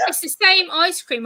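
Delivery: mock-indignant driver; hammed-up disbelief; amazed driver. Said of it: "he goes".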